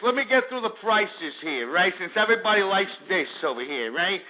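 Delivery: mock Jersey guy reading market prices; Italian-American Jersey wise-guy patter; Jersey Shore accent. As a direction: read the figures in a put-on Jersey Shore accent. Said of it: "let me talk like this".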